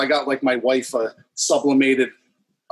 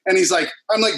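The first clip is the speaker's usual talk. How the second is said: ordinary delivery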